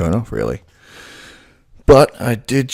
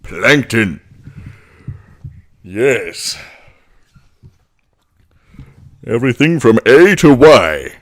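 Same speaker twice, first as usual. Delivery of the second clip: louder Plankton voice; small villain, boastful; 4 semitones higher, 6 dB louder, slower than usual. Shown as a demonstration. Doing Plankton for viewers, louder villain voice. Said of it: "We're up to".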